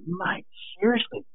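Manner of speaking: high, breathy whisper of disbelief; whispered plea. Retold or imitated. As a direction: hiss it under your breath, half pleading. Retold exasperated plea at surfer.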